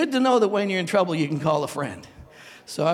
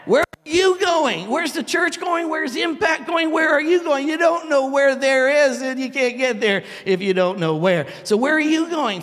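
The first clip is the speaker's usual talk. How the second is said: high, loud, slow preaching questions; 8 semitones higher, 6 dB louder than usual. Acted. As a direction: press the congregation with each question.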